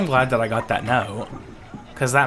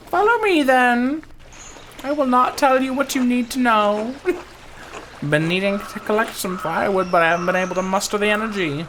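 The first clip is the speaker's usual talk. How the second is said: put-on high, louder old villager voice; old Ottilia, bossy; 10 semitones higher, 5 dB louder than usual. Old Ottilia leading her helper to the chores, bossy. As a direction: order them along in a high character voice.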